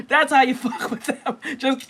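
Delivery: talking through a laugh at his own joke. Real, not acted. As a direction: still laughing through the words.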